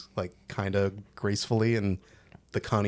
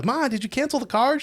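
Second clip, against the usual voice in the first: high, pleading call to mom; desperate son pleading with his mother; frantic son; 4 dB louder than usual. Retold or imitated. Connie frantic on phone to mom.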